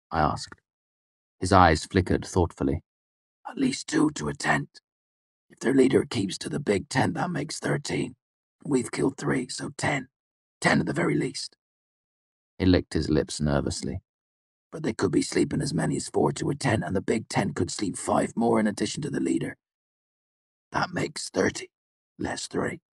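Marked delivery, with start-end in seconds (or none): 3.5-11.6 put-on higher, softer, uneasy young mercenary; a jittery fighter counting under his breath; nervous soldier voice. A narrator's reading. Martin voiced by narrator. 14.7-22.8 put-on higher, softer, uneasy young mercenary; a jittery fighter counting under his breath; nervous soldier voice. A narrator's reading. Martin voiced by narrator.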